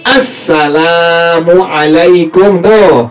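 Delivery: "Assalamualaikum" very slow, la drawn out; long la. Shown as a demonstration. Teacher showing the long la to students. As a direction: stretch the la in "Assalamualaikum".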